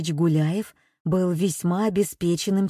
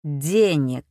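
low, louder, falling machine voice; metallic robot voice; 4 semitones lower, 4 dB louder than usual. A narrator's reading. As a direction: drop the word like a machine. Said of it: "произнёс механический голос".

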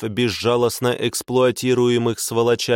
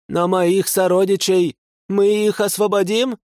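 trembling plea; 9 semitones higher than usual. A narrator's reading. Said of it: "Рыжика аж трясло".

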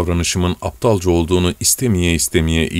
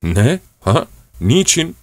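stammering surprise; 6 semitones higher, slower than usual. Acted.

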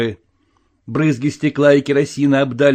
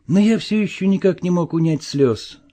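usual speaking voice

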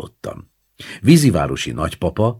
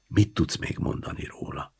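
softer unvoiced whisper; 8 dB quieter than usual. A narrator's reading. Grandma whispers to the child in the dark room, whispered. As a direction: whisper the question. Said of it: "Folytattam a sugdosást".

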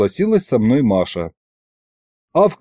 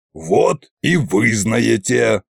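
ordinary delivery

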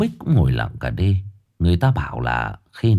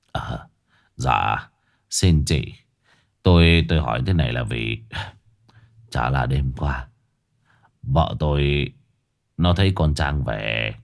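put-on hesitant old man; 7 semitones lower than usual. Bích reluctantly tells Xuân of his wife's dream, low and hesitant. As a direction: hedge and falter like he dreads saying it.

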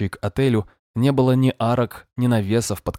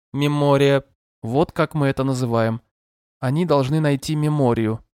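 gentle but monotone synthetic voice, higher; screen reader voice; 5 semitones higher, 3 dB louder than usual. Acted. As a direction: read it like a calm machine voice. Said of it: "звучал ласково, но монотонно".

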